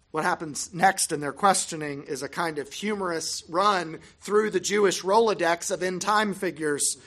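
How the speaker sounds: ordinary delivery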